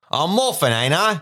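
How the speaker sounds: a hint of the drunk uncle; lightly hammed-up yokel; mild rough Morphin. Morphin voiced in reading.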